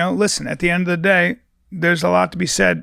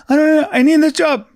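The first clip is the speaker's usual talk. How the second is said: pleading employee; 12 semitones higher, 6 dB louder than usual. Pretend.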